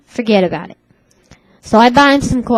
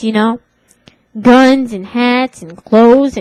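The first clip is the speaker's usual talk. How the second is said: usual speaking voice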